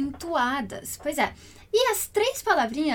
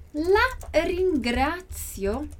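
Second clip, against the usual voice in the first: slow, drawn out; 2 semitones higher, much slower than usual. Shown as a demonstration. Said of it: "slow model of phrase".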